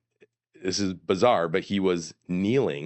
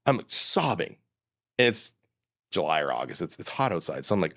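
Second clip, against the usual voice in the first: ordinary delivery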